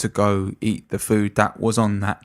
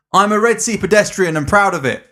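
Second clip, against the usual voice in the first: high, loud, proud declaration; film-line quote; 10 semitones higher, 5 dB louder than usual. Retold or imitated. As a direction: proclaim it like a film character. Quoting a favourite film line.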